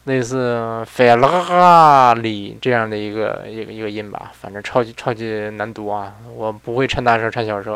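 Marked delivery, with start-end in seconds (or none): 0.0-2.6 费拉里 said slowly with an Italian ring; Italian-style pronunciation. Shown as a demonstration. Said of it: "按意大利，意大利语读呢". (慢慢用意大利腔念“费拉里”)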